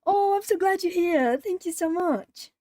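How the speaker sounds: high, gushing thanks; real delight, voice lifted high; glad. Real, not acted. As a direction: gush with gratitude to a friend. Welcomes and thanks the arriving friend.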